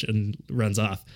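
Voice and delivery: usual speaking voice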